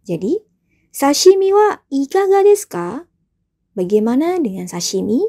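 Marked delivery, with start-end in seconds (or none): 0.9-2.9 raised, loud, polite ikaga desu ka; a teacher voicing a polite host; polite offer. Shown as a demonstration. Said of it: "Dan yang terakhir ada".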